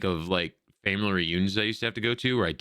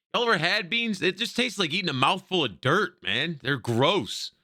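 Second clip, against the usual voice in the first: high-pitched, indignant disgust; incredulous griping, voice up high; disgusted rant; 9 semitones higher, slower than usual. Real, not acted. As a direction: complain in a raised pitch about awful food. Player ranting about hating beans.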